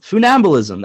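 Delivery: "Funambulism" said as foo-NAM-buh-lism, a little high; guessed pronunciation. Shown as a demonstration. Host settling on a guess, "Funambulism" as foo-NAM-buh-lism. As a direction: repeat the guess at "Funambulism" with an oo at the start. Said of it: "That's how I'm gonna guess it".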